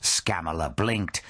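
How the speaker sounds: ordinary delivery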